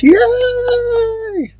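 high squealed cheer; excited cheer. Acted. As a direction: cheer it like a kid. Cheering about getting to play.

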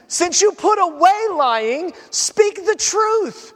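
high, slow, drawn-out declamation; emphatic preaching. Acted. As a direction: hammer the verse home, stretching each phrase high. Preacher hammers home the quoted verse.

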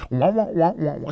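high, loud muted trombone wah-wahs; Snoopy teacher wah-wah. Retold or imitated. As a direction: imitate the Peanuts teacher's wah wah wah. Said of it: "like the teacher from Snoopy".

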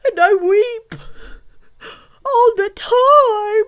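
very high, loud mock sobbing; bawling. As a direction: wail each word through hammed-up tears. Rhinoceros weeps in the story.

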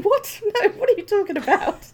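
very high, squeaky disbelieving what; high laughing protest at the haters; outraged disbelief. Retold or imitated. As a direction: shriek up in mock outrage, laughing. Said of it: "I'm thinking".

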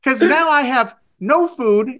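re-enacting a pressing demand; exasperated demand. Hungry customer pressing corporate agent, exasperated. Acted complaint to phone agent.

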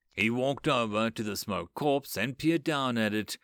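ordinary delivery

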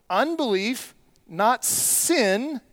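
ordinary talk

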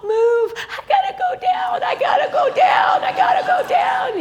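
high, loud crying plea, laughing through tears; a sobbing little girl begging to go to the altar; imitating her six-year-old self crying to her grandma; crying child.

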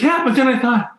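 very high-pitched mimicry, building to sharks; imitated panicky reasoning. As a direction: act out her fear spiralling step by step.

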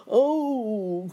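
high, loud drawn-out wail; hammed-up cry of pain. A narrator's reading. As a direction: howl it like a toothachy alligator. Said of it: "cried the alligator".

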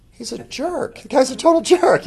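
high mocking put-down; mock disgust. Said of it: "and you're like".

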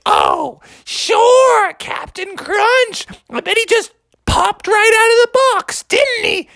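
high, drawn-out mock belief; sarcastic mom. Mom doubting the boy's excuse, sarcastic. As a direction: stretch it with scornful sarcasm. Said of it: "And Mommy's like".

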